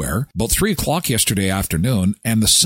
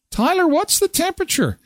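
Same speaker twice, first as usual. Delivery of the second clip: much higher mock wife voice; imitating wife. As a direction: play the wife asking sweetly. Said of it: "That's code for turn up the furnace".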